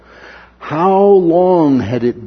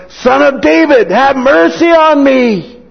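high, loud, slow desperate cry; 14 semitones higher, 7 dB louder than usual. Retold or imitated. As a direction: cry out over the crowd. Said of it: "kept crying out all the more".

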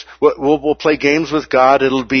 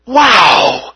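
high, loud cry of wonder; amazed wow; 8 semitones higher, 5 dB louder than usual. Acted. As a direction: burst out in astonishment. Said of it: "We will be going".

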